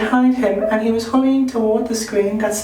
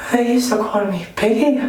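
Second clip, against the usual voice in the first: ordinary delivery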